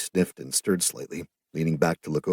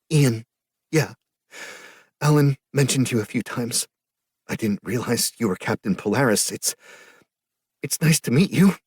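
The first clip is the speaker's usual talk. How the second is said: put-on higher, louder, wobbly girl's voice; tearful young woman; 6 semitones higher, 4 dB louder than usual. A narrator's reading. Grieving sister meeting Captain Polaris, high and teary. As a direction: hold back the tears as you greet him.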